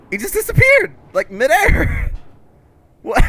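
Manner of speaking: loud, high disbelief; real surprised shout; shocked yelling. Real, not acted. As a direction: yell in real astonishment. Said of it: "thing vanished midair".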